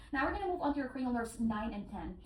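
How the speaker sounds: usual speaking voice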